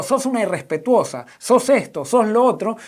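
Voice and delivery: mock accusation. Acted accusation of a spouse.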